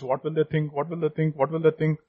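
hushed fretting. Acted. Preacher mocking those who walk on eggshells, hushed fretting. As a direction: fret under your breath, as if walking on eggshells. Voicing worry of walking on eggshells.

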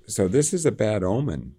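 usual speaking voice

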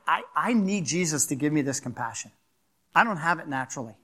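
ordinary delivery

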